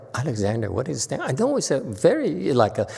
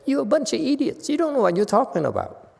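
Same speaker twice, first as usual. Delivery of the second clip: higher, fast, scornful; scornful dismissal; 8 semitones higher, faster than usual. Retold or imitated. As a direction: brush the scholars off. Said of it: "and say".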